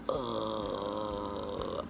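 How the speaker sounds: very low, quiet croaky grunt from deep in the throat; low vocal fry groan. Shown as a demonstration. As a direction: let a tired low creak fall out. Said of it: "You can hear how it's just like".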